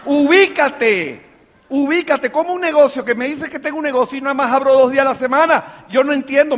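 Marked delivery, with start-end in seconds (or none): 0.0-1.2 very slow, stretched-out admonition; exasperated, drawn-out. Real, not acted. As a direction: drag the word out in exasperation. Exasperated call to wake up. (regaño exasperado, alargando la palabra)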